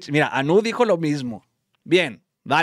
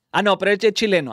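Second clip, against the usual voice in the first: ordinary talk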